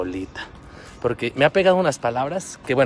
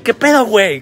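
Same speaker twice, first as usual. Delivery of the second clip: Mexican slang greeting; 8 semitones higher, 6 dB louder than usual. Retold or imitated. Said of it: "Es como para decir".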